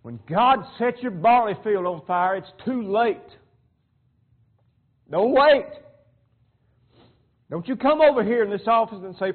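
loud, high preacher's exhortation; full-force preaching. Real, not acted. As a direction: drive it home to the congregation.

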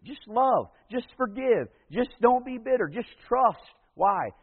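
high, loud rising exhortation; preaching crescendo. Acted. Building preaching climax.

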